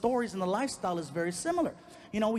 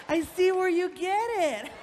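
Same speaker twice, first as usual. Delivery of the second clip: high, loud, drawn-out squeal; hammed-up girlfriend in hysterics; imitating laughing girlfriend; 12 semitones higher, 6 dB louder, slower than usual. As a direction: squeal it slowly, way up high, through mock hilarity. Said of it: "My girl's laughing".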